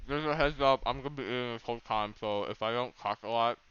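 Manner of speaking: talking through food; words round a mouthful; mouth full. Real, not acted. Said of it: "He says with a mouthful of food".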